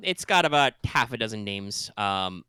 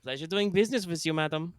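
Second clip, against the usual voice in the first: put-on space German accent; 6 semitones higher than usual. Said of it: "My, my space German accent".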